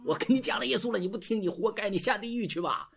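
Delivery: high-pitched, hectoring rant in parody; mock scolding shout. Misguided believer scolding someone who rejects Jesus, mock angry shouting. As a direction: act out a pushy evangelist losing patience. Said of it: "你不要跟着他大声喊啊".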